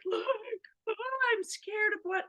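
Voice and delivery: put-on high tearful child's voice; high tearful child showing the broken headphones; crying child.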